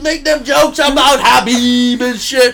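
high, loud hyped-up shout; mock hype shout. Comedian sarcastically cheering on Doc Raj, in a loud mock hype shout. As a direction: announce the comic like a hype man. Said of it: "Doc Raj".

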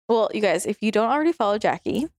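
ordinary delivery

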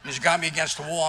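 usual speaking voice

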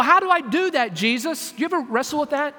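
usual speaking voice

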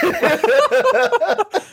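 very high laugh burst. Real, not acted. Laughing at co-host.

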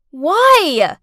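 loud, high, exasperated yell; angry scream. A narrator's reading. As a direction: scream it in the child's face. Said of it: "I screamed at Clarice's face".

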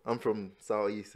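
ordinary talk

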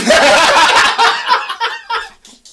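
shrieking laugh; high laugh. Real, not acted. Laugh after shout.

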